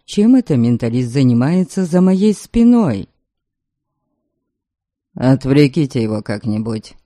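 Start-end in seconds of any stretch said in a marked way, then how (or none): 5.1-7.0 low, curt order; commanding. A narrator's reading. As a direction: give a calm low command. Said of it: "приказал мне Норберг".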